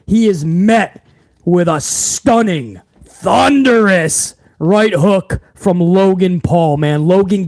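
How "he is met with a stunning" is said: ordinary talk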